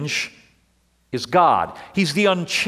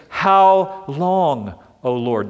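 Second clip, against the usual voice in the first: psalmist's lament; 3 dB louder, much slower than usual. Retold or imitated. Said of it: "the psalmist asks this question".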